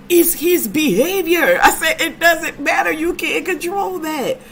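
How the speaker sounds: high and loud, words cracking with laughter; laughing hard. Real, not acted. Retelling the husband's reaction to friends, high laughing squeal.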